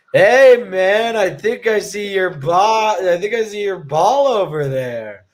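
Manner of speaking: put-on slurred, slow drunk voice, starting high and loud; imitating a drunk. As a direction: play a kid hamming up being drunk. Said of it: "he's gonna act drunk as well".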